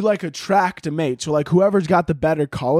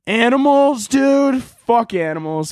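loud, high-pitched holler; mock outrage; 9 semitones higher, 4 dB louder, slower than usual. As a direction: holler it in comic exasperation. Mock rant opening on animals.

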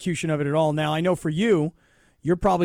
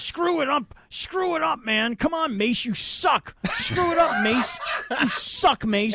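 mock heckling; 8 semitones higher, slower than usual. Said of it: "look at you with this, like, anger".

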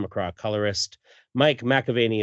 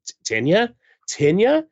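halting question, rising higher on repeat; acted dawning realisation; 7 semitones higher, 3 dB louder than usual. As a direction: stammer the name, then lift it in hope. Hero wakes to loved one's name.

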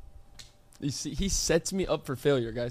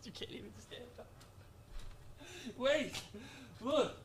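ordinary talk, nothing marked

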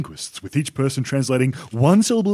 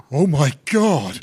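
mock horror; 3 semitones lower, slower than usual. Reporter shocked by Nile link.

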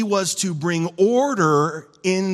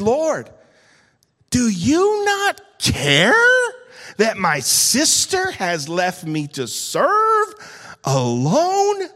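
Martha complaining; 3 semitones higher, 4 dB louder, slower than usual. Acted. Martha complains to Jesus, acted.

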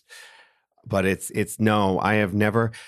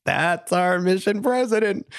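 amused missionaries; 10 semitones higher than usual. Retold or imitated. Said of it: "they were like".

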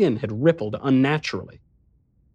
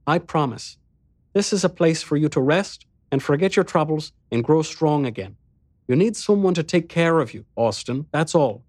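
soothing Hamarta; 5 semitones higher than usual. Acted. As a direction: soothe him like a caring hostess.

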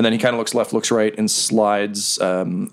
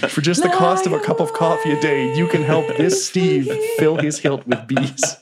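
higher and slower, a mock heartfelt donation appeal; charity ad parody; 6 semitones higher than usual. Mocking the bee-sword Steve as a charity case, a hammed-up appeal read. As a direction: plead for pennies like a late-night charity ad.

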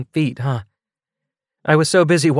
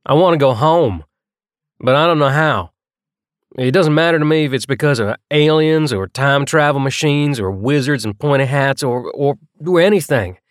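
put-on raised-voice outburst, higher and heavily accented; impassioned, louder, building insistence; louder, thick accent; 6 semitones higher, 5 dB louder than usual. A narrator's reading. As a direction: let it burst out loudly with a heavy accent. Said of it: "His voice had gotten louder".

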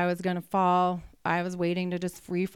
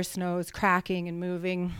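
usual speaking voice

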